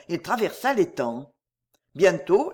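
usual speaking voice

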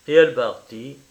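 ordinary delivery, nothing marked

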